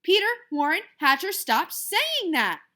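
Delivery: loud, high full-name holler; mother hollering her son's whole name. Acted. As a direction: mom hollering at her son. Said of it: "Mom hollered".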